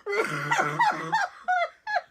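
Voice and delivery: very high crying laughter; a real near-tearful laugh; teary laughing fit. Real, not acted. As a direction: laugh till you cry.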